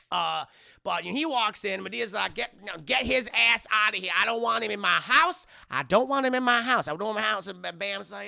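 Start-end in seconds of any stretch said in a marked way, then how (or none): 2.3-7.5 loud, acted-out indignant outburst; Madea's angry protest. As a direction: throw him out, loudly, in mock anger. Acting Madea angrily refusing a guest.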